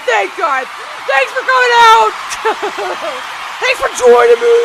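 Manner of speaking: high, loud, hammed-up hype voice; over-the-top announcer. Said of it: "let's just change the vibe".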